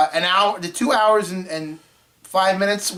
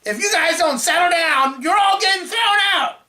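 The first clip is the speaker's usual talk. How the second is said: rising higher into a shout; retold angry warning; 10 semitones higher than usual. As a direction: homeowner scolding the line, building to a shout. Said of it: "she's like".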